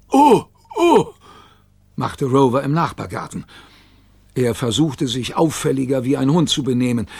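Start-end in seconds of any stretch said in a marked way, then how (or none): 0.1-1.1 very high, loud hooted uh-oh; alarmed dog voice. A narrator's reading. Rover hearing the Gigglerin's call, high alarmed yelp.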